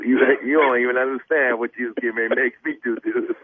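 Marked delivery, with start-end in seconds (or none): none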